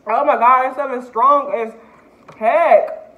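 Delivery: burning mouth, loud and teary; teary from spice. Real, not acted. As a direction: exclaim through tears from the heat. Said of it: "I'm, like, crying".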